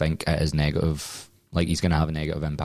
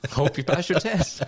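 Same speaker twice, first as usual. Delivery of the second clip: laughing; 9 semitones higher, slower than usual. Real, not acted. Laughing sign-off joke.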